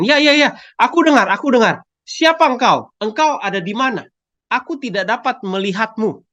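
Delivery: grab at the voice with frantic relief; eager relief. Acted. Jack answers the voice eagerly.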